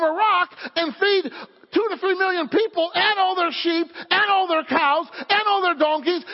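high, loud, driving preacher's proclamation; full-force preaching. Real, not acted. Preacher proclaiming God's promise to the congregation, high and fervent.

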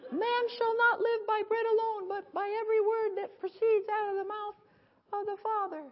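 high, whimpering mock plea through laughter; mock whiny weakling. Preacher mocking a feeble believer, whiny high mock plea. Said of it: "It's not".

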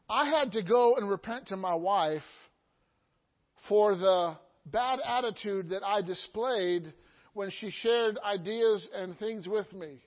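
usual speaking voice

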